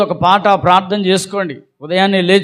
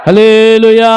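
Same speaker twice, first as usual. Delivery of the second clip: loud, sustained jubilant call; loud hallelujah; 3 semitones higher, 8 dB louder, slower than usual. Real, not acted. Preacher leading the congregation in a joyful hallelujah, loud call. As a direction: shout it out to rouse the congregation. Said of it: "గట్టిగా హల్లెలూయా చెప్పండి".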